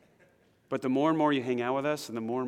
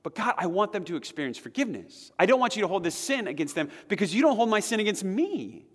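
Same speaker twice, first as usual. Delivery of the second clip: higher, pleading prayer, slowing to a hushed close; earnest prayer; 6 semitones higher than usual. Pretend. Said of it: "I can also pray".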